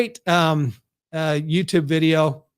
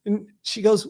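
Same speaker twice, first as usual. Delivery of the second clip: normal talking voice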